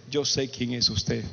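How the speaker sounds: slow, low, ominous reply; menacing stranger. Retold or imitated. Hostile passenger answering the priest after a stare, slow and ominous. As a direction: drop deep and draw out each word.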